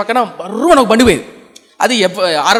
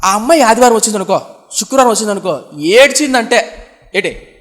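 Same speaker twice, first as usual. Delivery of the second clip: ordinary talk, nothing marked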